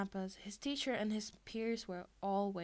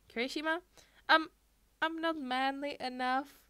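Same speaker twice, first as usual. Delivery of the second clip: higher, louder anxious cry; 5 semitones higher, 6 dB louder than usual. A narrator's reading. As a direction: cry it out nervously. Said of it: "Kirishima cried out".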